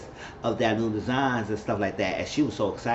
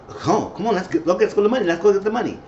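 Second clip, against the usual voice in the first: mock eager; 8 semitones higher than usual. Voicing eager Roderick.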